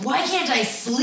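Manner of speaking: hammed-up frustrated outcry; mock exasperation. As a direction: imitate a wired person lying awake, exasperated. Acts out lying awake wired.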